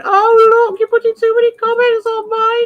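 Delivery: high, loud, whining wail; hammed-up crybaby, held high throughout; mock crying. Imitating idiots upset by too many comments in chat, mock-crying wail. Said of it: "they start crying and going".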